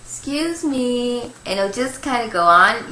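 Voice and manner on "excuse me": put-on slow, politely pleading child's voice; a grandson asking nicely again and again; mock-polite little kid's excuse me; polite little boy. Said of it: "using his polite voice".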